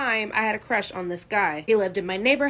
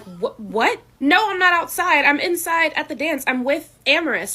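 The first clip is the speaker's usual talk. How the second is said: high, loud flustered denial; startled teen protesting; confused protest; 5 semitones higher, 4 dB louder than usual. Retold or imitated. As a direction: insist you were inside, rattled. Daughter confused, defending herself.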